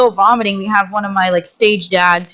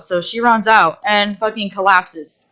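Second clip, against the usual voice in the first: normal talking voice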